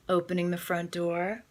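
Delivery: usual speaking voice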